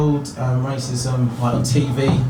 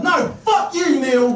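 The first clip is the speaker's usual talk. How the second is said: high, indignant shout at a mate; acted exasperated refusal; angry outburst; 10 semitones higher, slower than usual. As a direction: snap back furiously, pitch shooting up.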